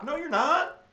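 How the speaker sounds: no-nonsense, hammed-up rebuttal; mock stern refusal. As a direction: slap the idea down like a stubborn parent. Teacher mock-scolds the idea.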